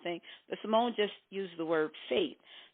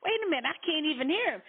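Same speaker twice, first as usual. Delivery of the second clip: acted alarm; 8 semitones higher, faster than usual. Acted spouse losing the other.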